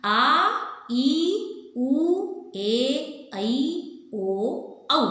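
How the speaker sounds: आ, ई, उ, ए, ऐ, ओ, औ called out loud and drawn long; long vowel demo. Shown as a demonstration. Teacher calling out long vowels for pupils, loud and drawn. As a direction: stretch each long vowel out loudly. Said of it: "ये सात हैं".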